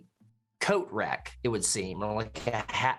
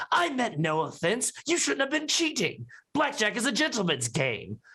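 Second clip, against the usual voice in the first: higher, haughty dandy; prissy gentleman; 9 semitones higher than usual. Acted. Foppish gentleman scolding Ross for cheating, prissy and high. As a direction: lecture on gentlemanly manners. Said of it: "The gentleman goes".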